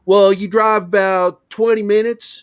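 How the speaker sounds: high, slow imitation of a country local; country local giving directions. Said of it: "in Missouri too".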